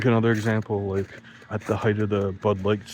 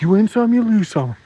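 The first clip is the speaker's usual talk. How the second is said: much higher, louder sing-song mimicry; mocking buddies; 12 semitones higher, 7 dB louder than usual. Teen mocking his soccer buddies' cliché, high sing-song. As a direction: parrot the friends in a silly high voice. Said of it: "my buddies would be like".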